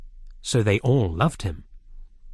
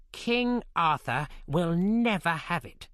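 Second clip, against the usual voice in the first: Morgan calls out, slow and higher; crying out; 5 semitones higher than usual. A narrator's reading. Evil queen crying out at the lake. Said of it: "she cried".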